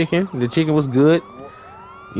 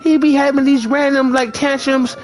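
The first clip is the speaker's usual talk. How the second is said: lightly voiced apologetic girlfriend; 9 semitones higher than usual.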